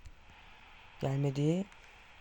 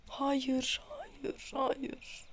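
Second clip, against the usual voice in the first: pleading no, softer, ending whispered; softer and softer, last one whispered; fading no to whisper; 9 semitones higher, 9 dB quieter, much slower than usual. Real, not acted. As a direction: let the voice shrink from a soft plea to a breath. Dreading a shot against him.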